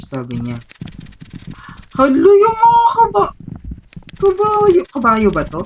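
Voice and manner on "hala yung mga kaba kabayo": high loud alarmed cry; startled cry. Real, not acted. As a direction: gasp it out in alarm. Startled by a horse in game.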